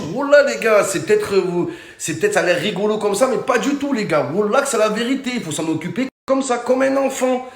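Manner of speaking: usual speaking voice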